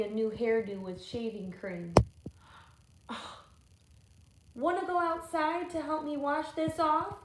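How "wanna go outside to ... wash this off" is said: put-on lightly higher little-girl voice; Sophie's voice. Sophie asking Wendell to play, light girl voice. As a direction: invite him kindly.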